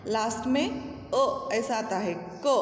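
ordinary talk

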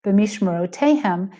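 B'mishmerotehem said smoothly at normal pace, Israeli style; authentic Israeli accent. Shown as a demonstration. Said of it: "is how a more authentic".

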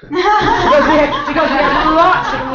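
laughing through the words, pitch up at the start. Real, not acted. Teacher laughing while explaining animal karmas to her listener. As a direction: let the laugh carry the line.

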